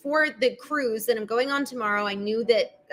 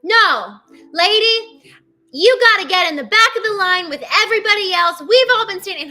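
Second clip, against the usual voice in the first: high, loud telling-off; loud, high-pitched public rebuke; loud indignant man; 8 semitones higher, 8 dB louder than usual. Retold or imitated. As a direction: raise your voice and scold a line-jumper. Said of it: "he gets loud".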